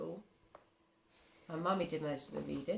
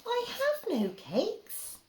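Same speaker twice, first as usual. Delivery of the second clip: very high, slow squeaky rabbit; timid rabbit; 7 dB louder, much slower than usual. Acted. Parent voicing the rabbit answering the rat, squeaky high. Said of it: "the rabbit replied".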